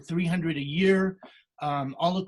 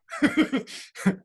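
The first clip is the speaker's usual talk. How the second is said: short laugh; 11 semitones higher than usual. Real, not acted. Laughs after saying he quit.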